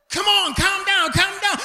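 high, loud urgent coaxing; trying to calm someone. Retold or imitated. Friend trying to calm an agitated man, loud pleading. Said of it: "in a state of agitation".

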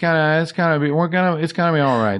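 lightly voiced Sonny, tired and sheepish; Sonny on the phone. S0 plays Sonny.

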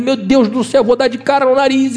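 high, alarmed mock panic; panicked cry. Preacher voicing a panicked person in a crash, high and alarmed. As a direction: cry out as the plane goes down. Falling plane, panicked prayer.

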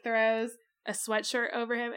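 normal talking voice

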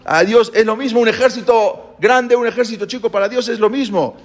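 rally the frightened troops; rousing commander. Retold or imitated. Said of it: "Les dijo a Yehudá".

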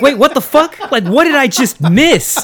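high, loud startled outburst; suddenly lost and baffled; shocked disbelief. Retold or imitated. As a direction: blurt it out in a confused panic. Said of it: "And I'm like".

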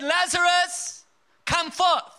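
high loud command, slowly drawn out; booming call to Lazarus. Acted. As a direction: summon the dead man with authority. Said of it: "He, he said".

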